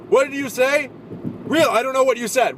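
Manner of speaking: high, loud comeback; flustered shout. Retold or imitated. Actor who can't fight back answering a heckler, flustered high shout. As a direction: yell it like a flustered performer. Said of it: "So I just shout unintelligibly".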